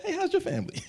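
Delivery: high, laughing, sugary greeting; a high, overly sweet fake greeting; fake sweetness. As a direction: greet them with exaggerated niceness. Fake friendly outward greeting.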